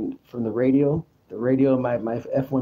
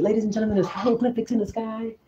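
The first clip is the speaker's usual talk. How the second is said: put-on higher-pitched old radio broadcast announcer; Orson Welles; 7 semitones higher than usual. Broadcaster reporting angels falling from the sky, announcer impression. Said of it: "I wanna sound like Orson Welles".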